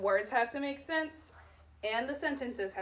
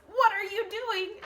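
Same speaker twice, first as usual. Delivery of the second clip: higher, louder "What are you doing?"; exclaimed question; 9 semitones higher, 5 dB louder than usual. Shown as a demonstration. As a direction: ask it with alarm. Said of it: "because she's saying it".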